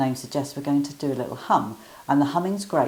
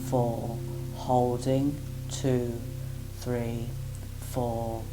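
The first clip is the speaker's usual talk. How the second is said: guided breath counting; much slower than usual. Acted. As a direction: count each breath evenly and calmly.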